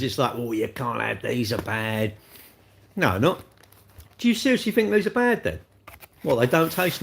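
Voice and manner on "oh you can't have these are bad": slow, low mocking guru voice; mock slimming guru. Streamer mimicking slimming gurus warning him off cakes, slow mock disapproval. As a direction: drag it out softly, imitating a disapproving diet guru. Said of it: "all these knobby slimming gurus".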